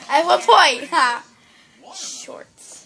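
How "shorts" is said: unvoiced whisper. Shown as a demonstration. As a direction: breathe the word with no voice. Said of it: "the backwards whisper".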